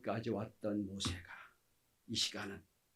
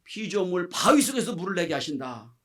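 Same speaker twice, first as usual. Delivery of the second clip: loud, raised-pitch declaration to the crowd; emphatic public testimony; Moses proclaiming rightly; 4 semitones higher, 7 dB louder than usual. Acted. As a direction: proclaim God's greatness with rising force. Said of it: "이렇게 해야죠".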